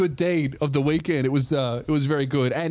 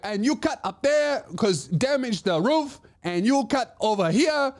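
put-on high-pitched Chinese lady's accent; high Chinese-accented imitation; 9 semitones higher than usual. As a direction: mimic a little Chinese lady's accent, bossing someone around. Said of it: "do the accent for that story".

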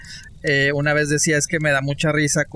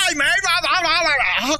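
much higher, strange put-on voice; 13 semitones higher, faster than usual. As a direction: do a silly mock voice of how he really talks. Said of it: "cómo habla". (imitación aguda y exagerada)

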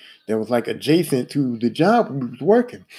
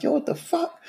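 high, softer mock outrage; retold anger; 7 dB quieter than usual. Worker retelling his reaction to the foreman, mad. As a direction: replay the outrage high but held back. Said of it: "so I'm mad".

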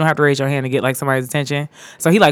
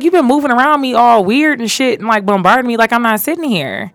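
retold exasperated complaint, pitched up; irritated; 6 semitones higher than usual. Retelling how she told off her coworker, irritated. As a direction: reel off the grievance in a higher, annoyed voice. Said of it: "I was just irritated".

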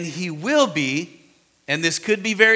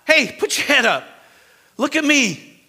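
higher, louder, urging call to look up; loud, lifting encouragement; Jesus urging John; 9 semitones higher, 4 dB louder than usual. Acted. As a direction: coax the fallen man to raise his head. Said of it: "Like this, so saying".

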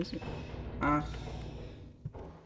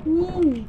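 startled yelp; 14 semitones higher, 9 dB louder than usual. Real, not acted. Plötzlicher Schreck im Spiel.

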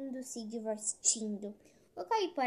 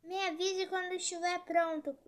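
slightly raised, higher character line; lightly acted, higher-pitched request; voiced Caipora; 6 semitones higher than usual. A narrator's reading. Caipora asks the tortoise to give word when ready, lightly voiced.

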